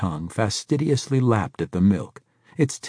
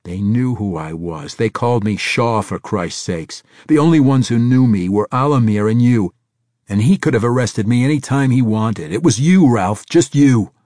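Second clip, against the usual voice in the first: loud, rising furious accusation; angry accusation; 4 semitones higher, 8 dB louder than usual. A narrator's reading. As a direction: hammer the betrayal home.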